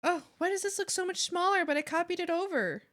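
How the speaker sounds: baffled complaint. Real, not acted. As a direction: ask it in exasperated disbelief. Baffled by software result.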